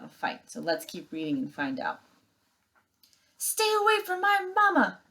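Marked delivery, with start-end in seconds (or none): 3.4-5.0 very high, fierce little bird cry; protective gosling. Acted. Brightbill shielding his robot mother from the bears, very high fierce cry. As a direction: shout it like a brave tiny goose.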